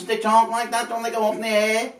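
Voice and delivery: high-pitched Scouse going up in the air; mock Scouse, voice climbing high; put-on Scouse accent. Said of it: "that's put on, that accent".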